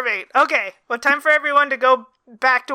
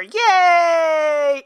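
loud, drawn-out higher cheer; celebratory cheer; 4 semitones higher, 3 dB louder than usual. Real, not acted. Celebrates hitting one hour.

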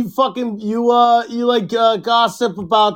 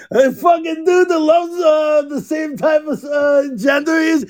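laughing shout; 8 semitones higher than usual. Real, not acted. Joke punchline, laughing shout.

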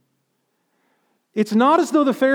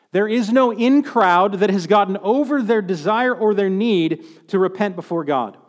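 ordinary delivery

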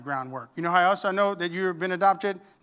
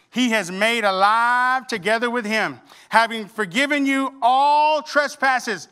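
slow, louder solemn reading; scripture reading; 4 semitones higher, 5 dB louder, slower than usual. Acted. Pastor reading Colossians aloud, slow and emphatic. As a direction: lean on each phrase slowly.

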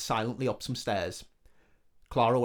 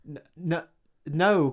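stammering; 5 semitones higher, 5 dB louder, slower than usual. Acted. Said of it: "Clara stammered".

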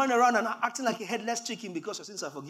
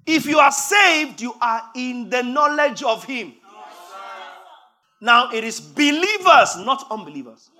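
preacher driving the point home, shouting; preaching shout; 4 semitones higher, 12 dB louder than usual. Real, not acted. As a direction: shout it out to the congregation.

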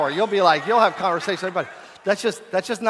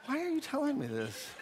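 high, soft voicing of a private thought; baffled inner thought; 7 semitones higher, 11 dB quieter than usual. Pastor silently wondering at a chatty stranger, baffled. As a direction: think it quietly, pitched up, puzzled. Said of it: "found myself standing there talking to somebody thinking".

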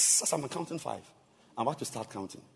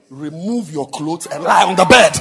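loud order; 5 semitones higher, 18 dB louder than usual. Retold or imitated. Bishop's order, laughing.

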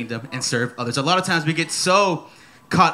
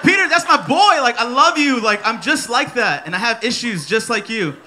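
excited praise; 5 semitones higher, 4 dB louder than usual. Retold or imitated. Preacher cheering Peter on.

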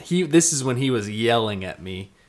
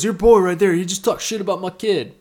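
angry dad; 8 semitones higher, 4 dB louder than usual. Retold or imitated. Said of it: "He goes".

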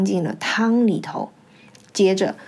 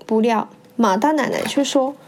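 ordinary delivery, nothing marked

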